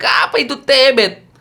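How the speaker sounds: put-on high puzzled "apa itu tebet?" in a Batak accent. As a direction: ask it baffled, Batak style. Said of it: "kata orang Batak".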